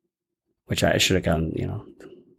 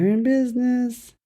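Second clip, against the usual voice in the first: imitating the scream; 7 semitones higher than usual. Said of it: "friend echoes the quote".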